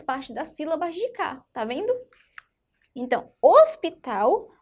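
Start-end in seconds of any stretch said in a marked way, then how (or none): 3.4-4.5 loud HOSPITAL opening on closed "ôs". Shown as a demonstration. Teacher shows S belongs to the first syllable, closed O. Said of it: "o som sai fechado".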